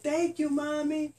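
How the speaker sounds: put-on high little baby thank-you. Baby bunny thanking its mommy in a high voice. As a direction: squeak it like a tiny baby.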